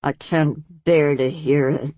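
low, slow, pained rasp. Acted. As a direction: grate the words out slowly. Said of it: "she rasped".

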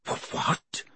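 breathless stunned stammer; words catching in shock. A narrator's reading. Harry hears he must not return, shocked stammer. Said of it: "Harry stammered".

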